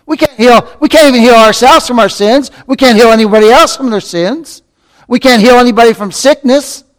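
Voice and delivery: loud, high, insistent preaching; full-force preaching. Real, not acted. Preacher pressing the point on his congregation, loud. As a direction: drive it home from the pulpit.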